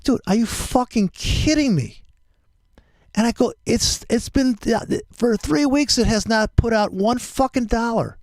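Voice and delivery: high-pitched, incredulous angry retelling; pissed off. Retold or imitated. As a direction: relive the complaint, voice pushed way up. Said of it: "I'm getting pissed off".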